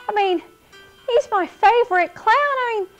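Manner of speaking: high, breathless delight; excited. Acted. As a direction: squeal with happy surprise. Thrilled by news of Obo.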